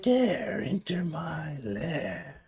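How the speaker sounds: slow breathy whisper of a dragon. Acted. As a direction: hiss it slowly from the shadows. GM voices dragon from behind.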